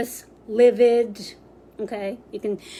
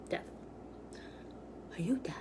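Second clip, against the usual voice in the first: whispering; 6 semitones lower, 20 dB quieter, much faster than usual. Shown as a demonstration. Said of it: "Not like".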